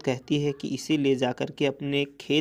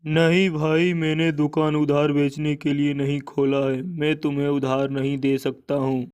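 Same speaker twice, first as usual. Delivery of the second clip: louder, firm shopkeeper; shopkeeper Lala refusing; 4 dB louder than usual. Acted. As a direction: turn him down firmly as Lala. (ज़ोरदार मना करता दुकानदार)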